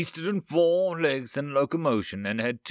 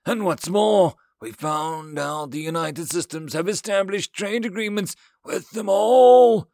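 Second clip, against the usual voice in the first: outraged yell; 8 semitones higher, 7 dB louder than usual. A narrator's reading. Alien counselor exposing the trade agreements, yelling. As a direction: rail at them, stretching the final words. Yelled tirade continues.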